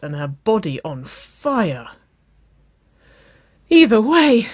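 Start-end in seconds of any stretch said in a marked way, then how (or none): 3.7-4.5 high, loud, urgent gasp. A narrator's reading. Waverley breaking in on Nicole, gasping loudly. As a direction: gasp it out, much higher. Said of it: "Waverley gasped".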